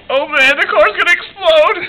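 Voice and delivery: high, hammed-up panicked cry; mock panic. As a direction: play the panic big and high. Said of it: "Act out your wreck".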